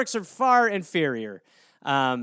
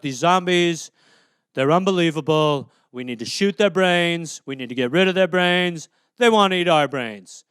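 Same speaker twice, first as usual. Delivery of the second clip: a light put-on Trump voice, louder; Trump impression; 2 semitones lower, 4 dB louder than usual. Trump rallying the country against zombies, hinted impression. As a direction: bluster about zombies like Trump. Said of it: "He'd be like".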